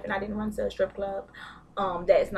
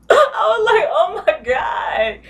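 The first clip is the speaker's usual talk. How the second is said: laughing disgust; 9 semitones higher, 10 dB louder, much slower than usual. Real, not acted. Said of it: "reacting to crying scene".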